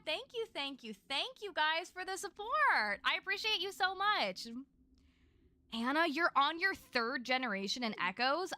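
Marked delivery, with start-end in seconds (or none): 0.1-4.5 gushing thanks. Real, not acted. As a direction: gush with gratitude, stretching the words. Gushing thanks for super chat.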